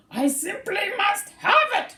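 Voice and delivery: high, loud pleading squirrel voice; longing for the cone, pitched high; wistful cry. Acted. Bruce the squirrel crying out for the cone. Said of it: "he wistfully cried".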